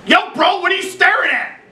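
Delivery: high, aggressive yell; mock stoned yell. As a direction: shout it paranoid at the clerk. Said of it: "starts screaming at me".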